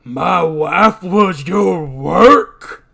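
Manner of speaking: slow, loud, rising disbelief; outraged shout. Acted. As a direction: roar it as an outraged question. Acted quarrel over dead wife.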